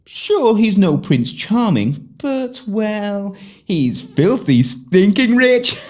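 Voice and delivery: ordinary delivery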